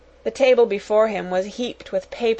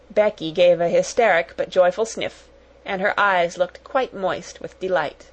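plain reading voice

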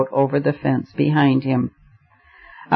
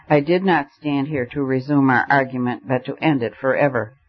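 ordinary delivery

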